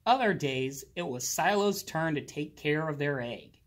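ordinary delivery, nothing marked

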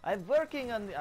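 abandoned stab at a German accent; attempted German accent. Shown as a demonstration. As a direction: try out a camp German accent. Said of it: "I don't know how to do that accent".

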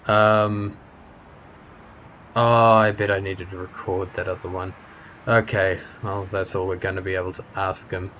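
ordinary talk, nothing marked

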